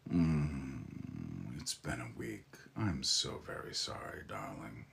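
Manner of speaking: low, hushed, breathy sympathy; soft sympathy. Real, not acted. To a viewer who had a rough week, hushed sympathy. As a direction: soothe a tired viewer softly.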